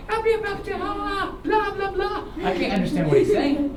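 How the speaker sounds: usual speaking voice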